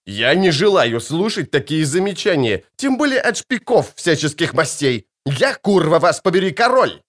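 huge roar, pitch climbing high; bull-like roar. A narrator's reading. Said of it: "на этот раз так, что не всякому буйволу удалось бы его перемычать".

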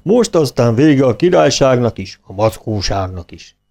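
plain reading voice

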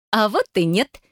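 high, playful refusal with a hint of giggle; giggling. A narrator's reading. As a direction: tease her, on the edge of a giggle. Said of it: "хихикнула Даша".

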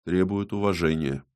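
subdued, earnest reply; serious. A narrator's reading. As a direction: answer soberly, without pushing. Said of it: "серьёзно ответил".

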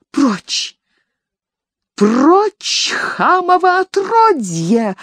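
put-on high, cracked old crone screeching; shrill old woman. A narrator's reading. Old nanny screeching at the servant, high and cracked. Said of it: "раздался визгливый, старчески разбитый голос".

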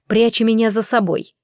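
usual speaking voice

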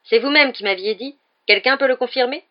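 put-on a boy insisting on his innocence; young Harry protesting. A narrator's reading.

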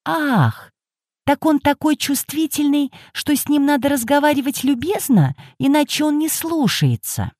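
put-on high, soft old woman; old lady voice. A narrator's reading. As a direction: voice the prickly old lady lightly.

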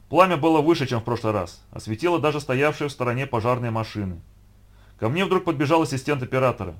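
ordinary delivery

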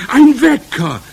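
very high, very loud screech; shriek. A narrator's reading. Witch audience reacting to the secret, shrieking. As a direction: shriek it in thrilled amazement. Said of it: "kreischten sie".

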